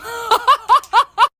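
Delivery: high, loud staccato laugh; gleeful performed cackle; giddy laugh. Acted. Laughs after agreeing to girls' day.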